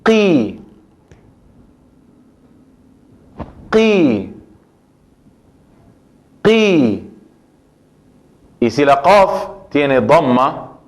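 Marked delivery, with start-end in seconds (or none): 0.0-7.1 sílaba qi repetida; syllable drill. Shown as a demonstration. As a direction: model qi. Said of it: "la voy a pronunciar".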